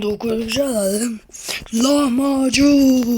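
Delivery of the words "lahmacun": slow, loud war cry; drawn-out battle cry. Acted. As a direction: stretch it out like an attack name. (uzatılmış savaş çığlığı)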